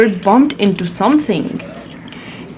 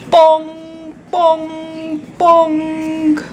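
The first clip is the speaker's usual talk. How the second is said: higher, ringing bong bong bong; a mother voicing the bell for her child; bell sound; 5 semitones higher, much slower than usual. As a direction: ring it out like a big church bell.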